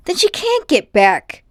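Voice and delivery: high, loud alarmed shout; boy realising Polly is stuck, shouting. A narrator's reading. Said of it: "shouted Diggory".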